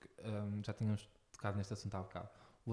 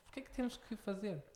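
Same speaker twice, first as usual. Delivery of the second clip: mock crying parent; 7 semitones higher, 5 dB quieter than usual. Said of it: "E é tipo".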